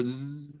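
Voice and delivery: soft buzzing zzz like a bee; teacher imitating a bee's buzz. Shown as a demonstration. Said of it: "abeja que vibra".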